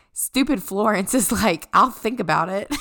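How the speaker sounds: loud, breaking up with laughter; laughing while reading. Real, not acted. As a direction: try to read it out but crack up. Cracking up reading co-host's notes.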